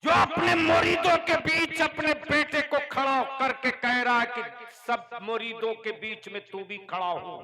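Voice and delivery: fiery preaching. Real, not acted. Cleric preaching against dowry to followers, thundering. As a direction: thunder it to the gathering.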